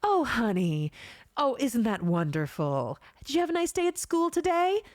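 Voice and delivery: sweet, doting anime mom. Shown as a demonstration. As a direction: coo it like a caring anime mother, rising high at the end. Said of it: "This is my anime mom voice".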